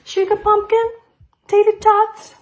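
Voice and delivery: a hint of a lovey-dovey wife; sugary endearments. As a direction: coo the pet names at him. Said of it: "Zelda said to me".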